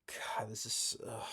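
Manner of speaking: breathy whispered groan; under the breath, fed up; exasperated whisper. Real, not acted. Exasperated aside while reviewing.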